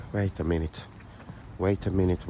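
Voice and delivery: ordinary talk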